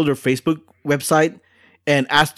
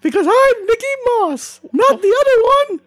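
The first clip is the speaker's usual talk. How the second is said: aggressive, very high cartoon falsetto; Nikki Moss; 3 dB louder than usual. Cartoon correspondent scolding Jiaming over his name, aggressive high falsetto. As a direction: scold in a squeaky mouse voice. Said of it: "Pretty aggressive".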